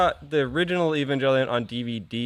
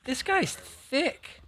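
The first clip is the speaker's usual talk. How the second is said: high squeaky voice; 12 semitones higher, 7 dB quieter, much slower than usual. Real, not acted. Joking about a thick model kit.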